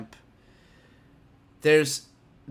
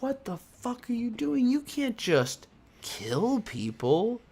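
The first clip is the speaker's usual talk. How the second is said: higher, slower shocked mother; mother scolding her son, higher and drawn out; scolding mom; 9 semitones higher, slower than usual. Retold or imitated. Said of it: "Amuro's mom is like".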